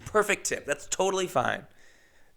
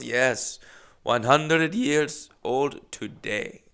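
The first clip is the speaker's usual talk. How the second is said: slow imitated Russian accent; thick Russian accent; much slower than usual. Old Russian lady confirms aunt's age, slow Russian accent. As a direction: drag the words out with a Russian accent. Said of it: "Very thick accent".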